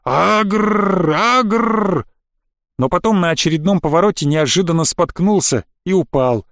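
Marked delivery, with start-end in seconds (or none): 0.0-2.1 repeated rolled-r growls, rising; villainous growl. Acted. Boy chasing his friend round the bunker, growling. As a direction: growl it like a playful monster. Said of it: "и всё приговаривал".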